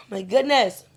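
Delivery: mid-yawn sigh into words. Real, not acted. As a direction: let a yawn stretch the words. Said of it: "I keep yawning".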